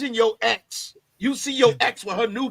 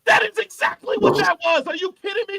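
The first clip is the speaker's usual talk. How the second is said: incredulous insistence; 6 semitones higher, 4 dB louder than usual. Real, not acted. Arguing over whose voice it was.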